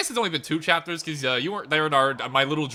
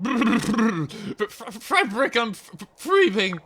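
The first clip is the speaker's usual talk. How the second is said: shivering; 4 semitones higher, much slower than usual. Acted. Character shivering in the cold.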